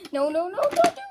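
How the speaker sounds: high, loud mock-frightened protest; panicked pleading. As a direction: pretend panic, rising to a squeal. Toy engine begs not to be hit.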